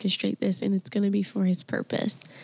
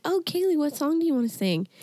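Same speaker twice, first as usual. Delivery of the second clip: sweet coaxing voice; 7 semitones higher than usual. Retold or imitated.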